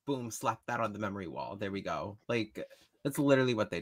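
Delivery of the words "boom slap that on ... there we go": quiet, low, offhand mock; careless mock quote. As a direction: toss it off like you don't care. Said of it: "and said".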